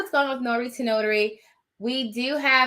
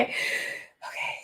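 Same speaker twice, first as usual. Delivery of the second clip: soft unvoiced okay; whisper; 9 dB quieter than usual. Real, not acted. Owner whispering to soothe the puppy, whispered. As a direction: breathe it out to calm the dog.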